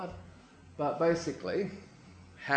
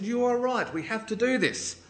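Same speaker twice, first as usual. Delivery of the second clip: high-pitched, stirred resolve; worked-up agreement; 8 semitones higher than usual. Acted. Remnant people answer the prophet, worked up. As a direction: agree as if fired up.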